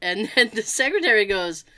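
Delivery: normal talking voice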